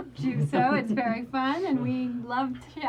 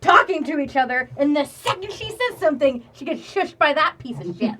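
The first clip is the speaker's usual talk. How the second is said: loud, raised-pitch mock-furious rant; super pissed; 5 semitones higher, 7 dB louder than usual. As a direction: vent it at full volume, half laughing by the end. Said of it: "Redwood is super pissed".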